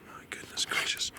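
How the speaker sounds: breathless whispered awe; hushed wonder; awed whisper. Real, not acted. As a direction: whisper it in amazement. Awed at the structures.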